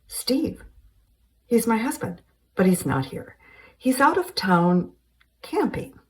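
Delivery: a startled wife on the phone; Carol's voice. Acted.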